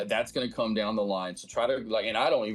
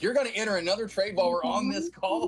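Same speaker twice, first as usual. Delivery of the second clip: incredulous; 10 semitones higher than usual. Retold or imitated. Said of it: "I'm like".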